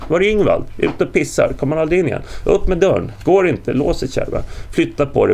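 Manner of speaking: high, loud panicked shouts; urgent alarm. A narrator's reading. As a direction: shout over each other at the jammed door. Men panic at jammed burning door.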